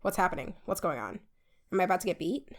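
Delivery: low, hushed, rattled aside; quietly uneasy, pitch dropped; triggered. Retold or imitated. Woman reliving hearing parents cuss, triggered. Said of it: "I get triggered".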